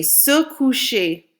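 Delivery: teacher models slowly; slow model for repeating. Shown as a demonstration. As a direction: say Se coucher slowly and clearly. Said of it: "Say it after me".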